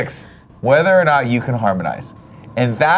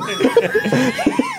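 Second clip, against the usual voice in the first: high laughing squeal; high squeal of laughter. Real, not acted.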